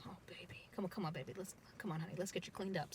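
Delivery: soft, hushed comfort; tender soothing. Retold or imitated. Gwen comforting the injured girl, soft and tender. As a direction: coax her gently like a hurt child.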